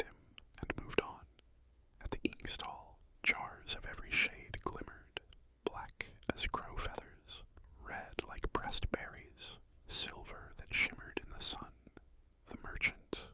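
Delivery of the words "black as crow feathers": ordinary delivery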